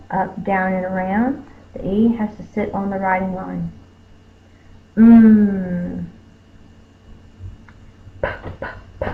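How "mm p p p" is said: a long hummed mm, then breathy unvoiced "p, p, p"; hum, then puff air for p; mm then popped p. Shown as a demonstration. Teacher contrasting hummed mm and puffed p for the class. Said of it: "My lips are closed, and then I pop the air".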